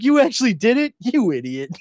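high, loud, laughing Homer voice; a goofy Homer yelling at Bart. Retold or imitated. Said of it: "he's like".

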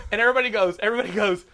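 ordinary talk, nothing marked

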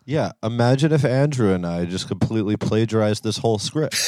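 ordinary talk, nothing marked

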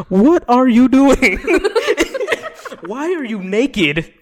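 Chris Hansen. Retold or imitated. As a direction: catch the naked guy off guard. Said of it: "In that classic voice".

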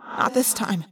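understated grim reply; a touch of grimness. A narrator's reading. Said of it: "I said grimly".

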